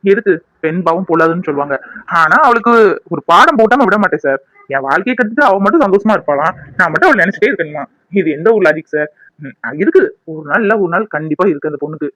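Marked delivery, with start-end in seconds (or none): none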